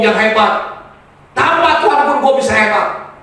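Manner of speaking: high, loud, driving sermon; full-force preaching. Real, not acted. Preacher warning those who would leave God, full-force preaching. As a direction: proclaim it forcefully to the whole congregation.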